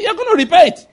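high-pitched retold indignation; angry friend. Friend confronting the driver who bashed his car, angry. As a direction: jump up in pitch on the demand. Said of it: "my friend was very angry".

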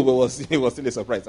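amused repeat, laughing; laughing retake. Shown as a demonstration. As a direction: say it again, chuckling at yourself. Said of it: "I mean, it was nice to know".

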